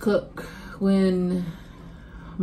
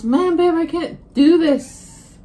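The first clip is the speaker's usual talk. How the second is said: high, loud, slow whine; mock helpless husband; whiny husband impression; 9 semitones higher, 7 dB louder, slower than usual. Wife quotes husband's complaint.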